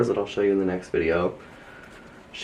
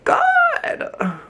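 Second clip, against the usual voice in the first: awed gasp; much slower than usual. Real, not acted. Gasping at gorgeous plant.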